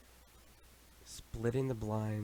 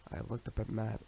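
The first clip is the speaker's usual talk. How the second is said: ordinary delivery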